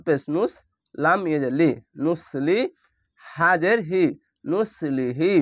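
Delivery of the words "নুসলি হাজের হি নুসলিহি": ordinary talk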